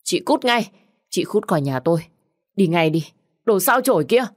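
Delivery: high, loud shrieking curses; wailing scream. Acted. Sister-in-law shrieks at widow to get out. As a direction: howl it at her. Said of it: "Nó gào lên thảm thiết".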